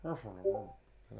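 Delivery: mouth full. Shown as a demonstration. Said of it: "He's saying it with a mouthful".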